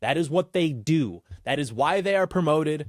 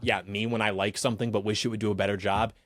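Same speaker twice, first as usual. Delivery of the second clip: ordinary delivery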